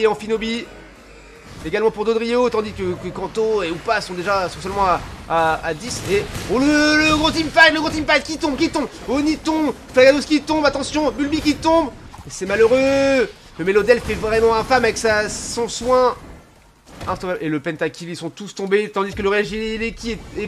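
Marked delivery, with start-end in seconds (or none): 6.1-16.2 excited high-pitched play-by-play; rapid hyped esports commentary; esports caster. Acted. Teamfight erupts, caster hypes.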